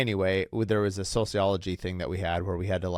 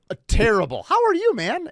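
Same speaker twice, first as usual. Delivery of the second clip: bright upbeat voice, much higher and louder; cheery terrible; 13 semitones higher, 8 dB louder than usual. Retold or imitated. Cheerfully greeting a stranger, chipper and high. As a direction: chirp bad news like it's great. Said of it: "But I would say it in a positive voice".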